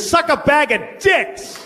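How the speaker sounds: imitated slow, high-pitched furious yell; angry shout. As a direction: mimic an enraged driver, drawing out each word. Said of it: "He was angry".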